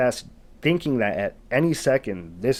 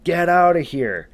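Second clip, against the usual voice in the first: raised storyteller's imitation of a yell; retold yell; 6 semitones higher, 5 dB louder than usual. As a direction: act out yelling at the top of your lungs. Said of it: "he yells again at the absolute top of his lungs".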